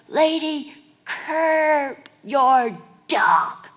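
loud, high biker's holler; retold yell. Biker yelling at a dog walker, loud slow shout. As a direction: holler it out slowly across the road. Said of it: "this one yells out".

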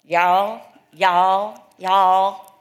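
loud, drawn Southern y'all; mock Southern accent. Californians moved to the South, mock Southern accent. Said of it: "what are they all saying?".

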